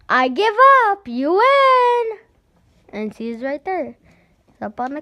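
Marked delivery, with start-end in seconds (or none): 0.1-2.2 put-on very high, loud kid's yell. Boy giving up the game, loud high yell. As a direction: holler it across the yard.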